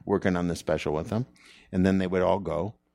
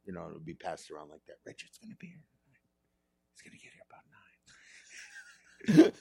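breathy secret whisper; 3 semitones lower, 6 dB quieter, faster than usual. Retold or imitated. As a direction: whisper it like gossip. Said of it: "Why are we whispering?".

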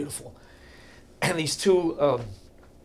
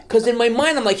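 normal talking voice

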